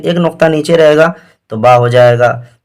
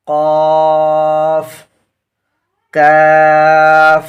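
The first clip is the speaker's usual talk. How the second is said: each letter name called out and held; teacher's chanting drill; alphabet recitation; 2 semitones higher, 3 dB louder, much slower than usual. Shown as a demonstration. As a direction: recite the letters slowly for the class to repeat.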